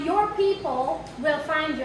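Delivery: ordinary talk, nothing marked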